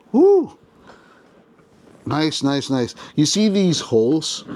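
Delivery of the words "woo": a single high, loud whoop of delight; excited whoop. Real, not acted. Toy reviewer pleased as the poncho comes off, high excited whoop. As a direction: let out a sudden happy whoop.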